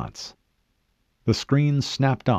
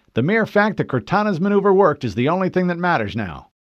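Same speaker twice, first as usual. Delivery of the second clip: higher, louder officer voice; disagreeing lieutenant; 9 semitones higher, 5 dB louder than usual. Acted. Lieutenant disagreeing with the Master Chief, raised insistent voice. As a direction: firmly correct your superior.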